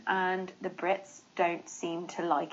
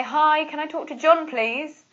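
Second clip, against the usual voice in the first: bright, higher, louder phone greeting; polite phone caller; 7 semitones higher, 6 dB louder than usual. Retold or imitated. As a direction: sound like a cheerful caller asking for John. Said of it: "I call someone on the phone and I say".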